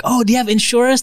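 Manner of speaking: imitating a pushy seller; mock salesperson. Sales staff asking about insurance, eager mock pitch.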